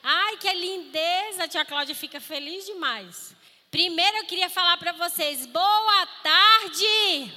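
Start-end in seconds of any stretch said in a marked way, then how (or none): 5.5-7.3 boa tarde bem alto e animado; saudação animada. Real, not acted. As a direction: chame as crianças com energia. Saudação alta às crianças. (saudação em voz alta)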